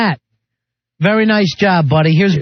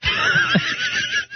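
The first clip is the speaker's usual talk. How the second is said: shrieking laugh; 8 dB quieter than usual. Real, not acted. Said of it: "That is awful".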